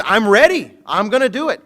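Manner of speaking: loud and high, brimming with confidence; Peter's eager vow. Retold or imitated. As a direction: promise it like nothing could stop you. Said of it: "And he says".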